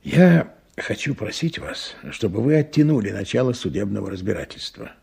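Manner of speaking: normal talking voice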